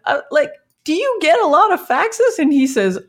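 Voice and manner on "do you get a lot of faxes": high, wide-eyed surprised question; high incredulous question; amazed question. Retold or imitated. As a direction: ask it as if faxes were a marvel. Asking the client, amazed.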